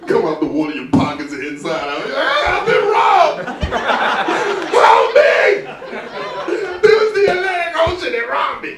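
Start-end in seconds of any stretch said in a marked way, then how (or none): 2.1-8.7 high, loud hammed-up panic; panicked cry for help. Robbed swimmer yelling to the beach, high mock panic. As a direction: wail like you just got robbed.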